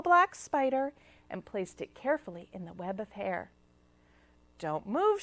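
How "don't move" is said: plain reading voice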